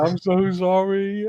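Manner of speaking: high, slow, pleading apology; apologetic lad. Retold or imitated. Retelling the lad sorry after the prank, high and pleading. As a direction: voice the scared lad, drawn-out and high. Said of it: "And he's like".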